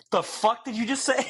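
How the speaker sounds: high-pitched, incredulous mock reply; mock bafflement. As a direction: play a catcher who can't believe his ears. Acting out baffled catcher's reply.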